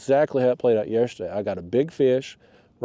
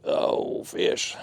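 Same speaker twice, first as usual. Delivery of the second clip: breathless whisper; 4 dB quieter than usual. Real, not acted. Fish fighting on the line.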